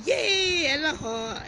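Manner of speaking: high, loud cheer of welcome; excited cheer. Real, not acted. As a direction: cheer loudly as a friend joins. Cheering a viewer who joins.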